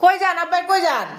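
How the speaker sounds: high call across the room. Real, not acted. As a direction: call after him as he leaves. Calling out to father leaving.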